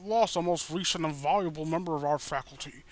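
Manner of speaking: a lightly voiced solemn school principal; lightly put-on principal voice announcing a death; principal's speech. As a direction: address the assembly like a grave principal.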